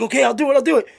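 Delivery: high-pitched, hurried-sounding giving in; acted scared agreement; panicked surrender. Guy giving in at gunpoint.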